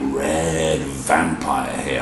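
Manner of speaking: low, very slow spooky drawl; mock-spooky voice. Storyteller presents the felt vampire, playfully spooky. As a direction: draw it out in pretend menace.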